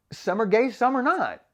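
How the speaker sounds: usual speaking voice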